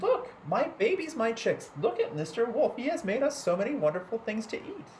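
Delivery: high, sweet mother hen voice. A narrator's reading. Hen showing her chicks the kind wolf, high and sweet.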